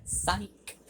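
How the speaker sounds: spring the trick on him lightly; taunting reveal. A narrator's reading. Fake rescuer springs her betrayal.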